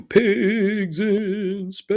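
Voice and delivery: high, loud, hugely drawn-out title call; imitation sketch-title announcer. Host recalling an old Muppet Show bit, mock announcer imitation. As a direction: stretch each word with a wavering echo-like flourish. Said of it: "in the style of Pigs in Space".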